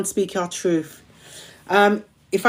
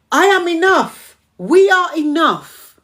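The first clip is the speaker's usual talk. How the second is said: rousing affirmation; 6 semitones higher, 4 dB louder than usual. Real, not acted. Affirmation proclaimed, far voice.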